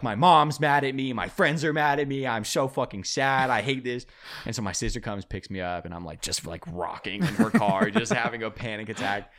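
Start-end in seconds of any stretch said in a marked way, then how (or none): 0.0-4.1 loud, higher retold meltdown; hammed-up upset whine, easing at the end; mock distraught wailing. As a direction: play up the self-pity of a panicking tripper. Acted meltdown during bad trip.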